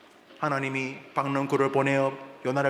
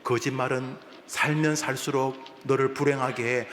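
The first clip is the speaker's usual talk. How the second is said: ordinary delivery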